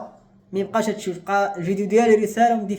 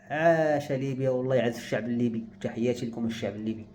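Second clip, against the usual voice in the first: sincere praise; 9 semitones lower, 8 dB quieter than usual. Real, not acted. Greeting the Libyan people sincerely.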